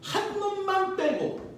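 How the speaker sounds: playful slow emphasis; drawn out. Shown as a demonstration. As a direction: drag out the punchline. Teacher drawls the exception.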